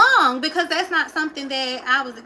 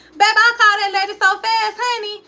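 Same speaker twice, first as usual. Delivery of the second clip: higher, fast excited rush; 7 semitones higher, 3 dB louder than usual. Real, not acted. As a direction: gush it out quick and thrilled. Excited telling.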